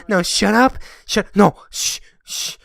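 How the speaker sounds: bossy shushing, cutting everyone off; domineering. Acted. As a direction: hush them and take over. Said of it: "you sound like an overbearing wife".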